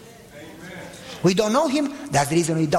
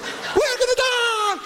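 high stretched-out panicked wail; mock terrified scream; slower than usual. Mister Ralph in the brakeless van, mock terrified scream. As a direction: cry out like the car is crashing. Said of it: "He was yellow screaming".

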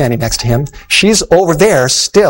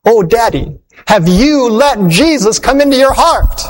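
put-on tearful little girl; 7 semitones higher, slower than usual. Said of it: "as only a little girl can".

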